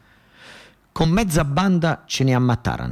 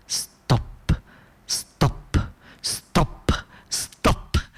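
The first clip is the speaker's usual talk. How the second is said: breathy unvoiced "stop", like droplets falling one by one; whispered "stop" plops; 6 semitones higher, much slower than usual. Storyteller mimicking a sparrow's droppings, whispered plops. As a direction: tap out each "stop" in a whisper. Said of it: "E la cacata faceva stop".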